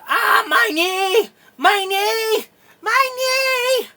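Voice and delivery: high, drawn-out wail of pain; pained screaming. Acted. Guard falls with a smashed kneecap, wailing in pain.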